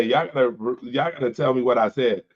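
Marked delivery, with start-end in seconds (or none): none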